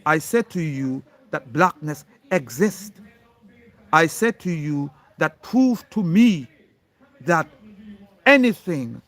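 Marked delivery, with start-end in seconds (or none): none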